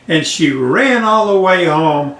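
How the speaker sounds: ordinary delivery, nothing marked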